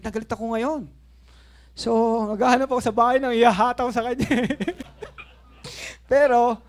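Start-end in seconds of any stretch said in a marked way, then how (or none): 1.7-6.6 loud, laughing retelling. Real, not acted. Pastor laughing to the congregation about his anger, loud laughter. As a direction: laugh through the memory of the anger.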